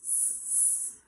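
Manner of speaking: soft hissing like a snake. Acted. For the kids, hissing like a snake. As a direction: hiss softly like a snake.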